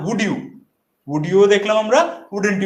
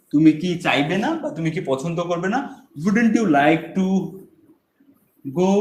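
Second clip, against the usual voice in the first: ordinary talk, nothing marked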